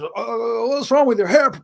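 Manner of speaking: high, loud, squawky imitation of a gung-ho sergeant; mocking sergeant. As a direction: play the petty army zealot barking about hair.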